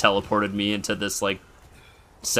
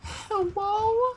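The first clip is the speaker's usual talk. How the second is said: very high, squeaky "hello"; mock-innocent falsetto greeting; playful little-stranger voice. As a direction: call out in a tiny, cutesy high voice. Cutesy intruder calling into cabin.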